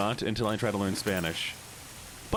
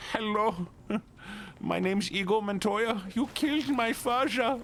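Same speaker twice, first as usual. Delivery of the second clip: high, hammed-up avenger voice; mock duelist; 12 semitones higher than usual. Swapping in Faja to amuse chat, high hammed-up duelist. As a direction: ham up the vengeance line, high and slow.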